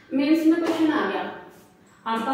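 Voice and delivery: loud, high, emphatic warning; punchy classroom emphasis. Real, not acted. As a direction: raise the voice to drive it home. Teacher stresses exam scenario.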